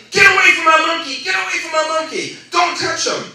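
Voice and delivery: high-pitched angry yelling; retold frantic shout. Trainer rushing in to boy who flipped monkey, acted shout. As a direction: shout someone away, imitating a panicked trainer.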